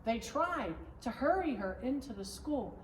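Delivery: ordinary delivery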